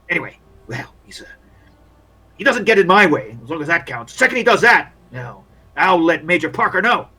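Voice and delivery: lightly voiced grumbling field doctor; disapproving army doctor. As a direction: mull over the odd soldier, disapproving.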